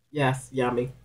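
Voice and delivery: ordinary talk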